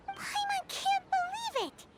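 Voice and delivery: high, slow, amazed exclamation; can't believe it, stretched high; disbelief. Acted. Paimon amazed they missed such a simple solution, high and slow.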